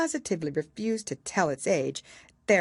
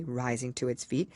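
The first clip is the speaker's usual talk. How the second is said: usual speaking voice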